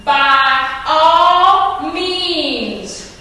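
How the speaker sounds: very slow, drawn-out sarcasm; sarcastic. Acted. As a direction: stretch each word out with mock patience. Sarcastic message painted on roof.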